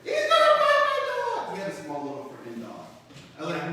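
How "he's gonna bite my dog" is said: shrieking way up high and loud, half laughing; high shrill panic scream over a dog; mock panicked scream. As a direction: imitate a grown man screaming in panic.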